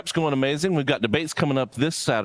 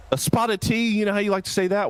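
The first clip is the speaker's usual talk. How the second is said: high mock-British voice; hammed-up, high-pitched British accent; put-on British accent; 6 semitones higher than usual. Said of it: "this is spot on accents".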